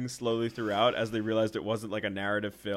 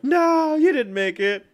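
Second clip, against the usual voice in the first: much higher, loud mock shout; 11 dB louder, slower than usual. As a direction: yell the correction like the boss. Mobster contradicts the son.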